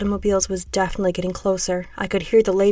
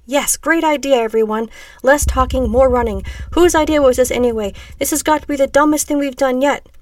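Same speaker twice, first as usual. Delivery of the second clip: loud, higher-pitched griping; sarcastic complaint; 5 semitones higher, 5 dB louder than usual. A narrator's reading. Woman to the others while running, loud sarcastic complaint. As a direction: rant at the whole group.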